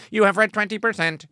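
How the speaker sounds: a hint of an eighties robot announcing progress. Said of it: "is like an eighties robot".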